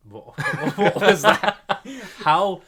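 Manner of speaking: high, loud laughing disbelief. Real, not acted. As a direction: crack up mid-question, slow and loud. Teasing friend, bursts out laughing.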